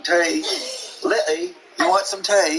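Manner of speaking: high-pitched mock British; put-on British accent. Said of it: "talk to her in a British accent".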